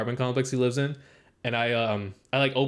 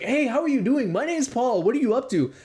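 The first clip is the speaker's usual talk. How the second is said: mock cheery greeting; 9 semitones higher than usual. Acting out greeting strangers.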